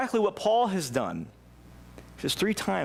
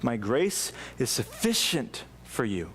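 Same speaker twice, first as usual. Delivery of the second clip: reverent quote; 4 semitones lower, slower than usual. A narrator's reading. Quoting scripture solemnly.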